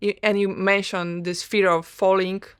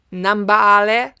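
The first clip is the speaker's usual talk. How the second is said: Polish accent; 8 dB louder than usual. Real, not acted. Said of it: "that's my Polish accent".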